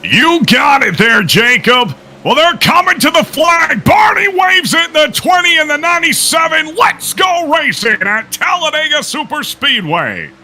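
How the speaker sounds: booming high monster truck ad shout; monster truck announcer. Pretend. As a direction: yell it like a monster truck rally ad. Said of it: "in your best monster truck voice".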